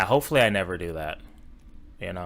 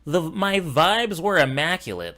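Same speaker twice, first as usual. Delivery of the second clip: a hint of a smug dork, pitched higher; lightly mock his self-satisfied line; mocking quote; 6 semitones higher than usual. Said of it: "I don't mean, like, his tone".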